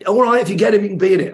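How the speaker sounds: high, loud mock agreement; high concession. Producers let him be in it, high-voiced agreement. Colleagues give in to his demand.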